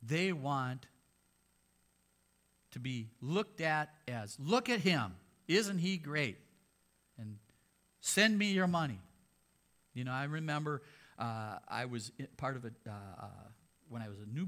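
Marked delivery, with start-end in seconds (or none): 4.4-9.0 loud, higher mock boast of a showman preacher; mocking false apostle. Preacher mocking a greedy teacher to congregation, loud and showy. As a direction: ham up the greedy celebrity preacher.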